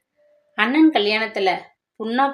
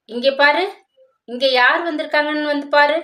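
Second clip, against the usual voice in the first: louder, higher call to someone in the next room; father calling his daughter in, raised happy call; 6 semitones higher, 4 dB louder than usual. Acted. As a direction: call her over from inside the house. Father calls wife from inside.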